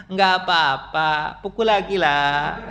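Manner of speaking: slow, lightly voiced meek man; meek invitation. Preacher playing a too-meek man offering his cheek, slow. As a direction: drag it out like a pushover.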